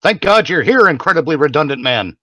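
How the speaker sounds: normal talking voice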